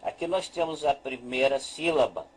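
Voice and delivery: ordinary talk, nothing marked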